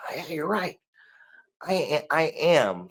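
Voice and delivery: soft, subdued concession; meek agreement. Retold or imitated. Imitating someone accepting a politely put insult, meek. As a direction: quietly give in, a little hesitant.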